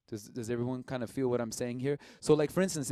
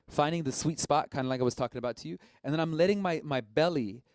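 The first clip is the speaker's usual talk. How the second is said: usual speaking voice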